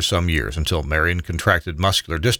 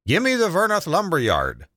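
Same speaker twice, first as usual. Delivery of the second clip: lightly acted yell down the line, pitched up; mild mock yell; 10 semitones higher than usual. A narrator's reading. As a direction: raise the pitch as if yelling an order. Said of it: "yelled into it".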